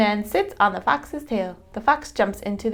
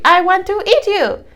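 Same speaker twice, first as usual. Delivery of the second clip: hungry Jill; 12 semitones higher, 6 dB louder than usual. A narrator's reading. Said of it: "says Jill".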